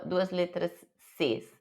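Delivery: ordinary delivery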